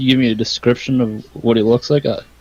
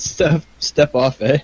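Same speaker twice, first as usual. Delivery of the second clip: put-on Canadian accent; 4 semitones higher, slower than usual. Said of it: "With a Canadian accent".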